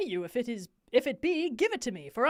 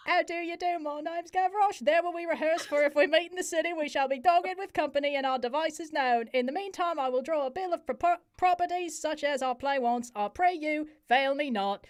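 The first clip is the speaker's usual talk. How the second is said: put-on Cockney accent pitched a little higher throughout; hammed-up Cockney accent; 4 semitones higher than usual. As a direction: play the lines as a cheeky, over-the-top Cockney lad. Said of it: "Cockney accent".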